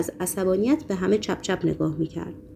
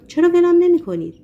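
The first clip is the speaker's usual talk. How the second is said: loud, high, rude snap; rude outburst; 9 semitones higher, 6 dB louder than usual. A narrator's reading. Patient lashes out at his doctor in a loud, high outburst. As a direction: Ivan yells at the doctor to leave him alone, loud and rude. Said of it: "ایوان با بی احترامی جواب داد".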